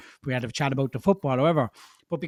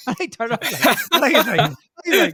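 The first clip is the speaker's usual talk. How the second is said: much higher, laughing through the words; laughing while telling; 7 semitones higher, 6 dB louder than usual. Real, not acted. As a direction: giggle it out as the memory hits. Recounting meeting Paul, laughing.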